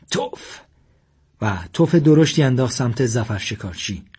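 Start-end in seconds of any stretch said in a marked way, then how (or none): 0.1-0.6 a short, unvoiced spat-out word; spiteful spit. A narrator's reading. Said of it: "و با غیظ گفت". (با غیظ و مثل تف کردن)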